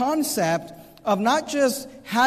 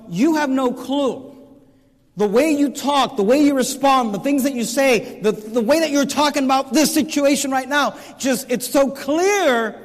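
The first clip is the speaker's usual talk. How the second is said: higher-pitched, insistent mock rebuke; exasperated rebuke; 5 semitones higher than usual. Preacher voicing his thoughts about clueless Christians, exasperated. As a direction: press each accusation, pitched up.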